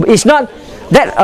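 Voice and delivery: ordinary delivery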